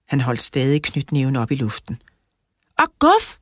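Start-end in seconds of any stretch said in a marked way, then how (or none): 2.8-3.4 high, cheeky yell; shouted. A narrator's reading. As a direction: pipe up high to join the teasing. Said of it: "råbte jeg".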